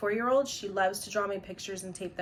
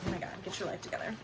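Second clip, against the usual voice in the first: fast muttered aside; 8 dB quieter, much faster than usual. Real, not acted. Mocks the name while reading it.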